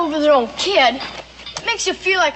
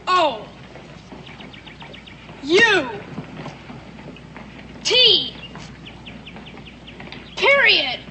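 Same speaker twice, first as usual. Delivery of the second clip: called out letters; 3 semitones higher, much slower than usual. Acted. Calling out game letters.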